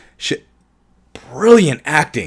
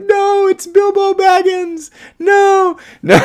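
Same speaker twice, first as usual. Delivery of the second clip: very high, slow, playful squeak; squeaky mock protest; 4 dB louder, slower than usual. Joking to viewers that Ash is Bilbo, a high squeak.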